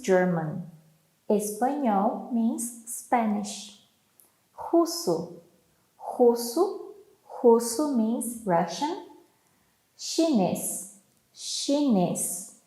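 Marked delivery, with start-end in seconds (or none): none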